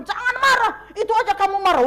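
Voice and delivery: high, loud angry retort; angry snap. Retold or imitated. Griever snapping at a comforter, high and loud. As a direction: snap back at the one urging calm. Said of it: "Dia bilang".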